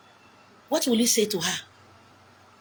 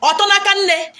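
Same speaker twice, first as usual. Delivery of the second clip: Otonakanne called out high and loud; emphatic word; 10 semitones higher, 7 dB louder than usual. Shown as a demonstration. Preacher warning proud girls, Otonakanne called out high and loud. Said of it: "you are becoming Otonakanne".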